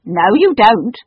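loud, firm no; firm refusal. A narrator's reading. The cook firmly refusing to go home. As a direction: refuse flatly, no doubt. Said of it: "in firm, undoubting tones".

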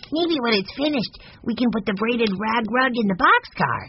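put-on high-pitched little boy voice; high, eager kid character; young Benny, eager. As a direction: pipe up like a keen small child.

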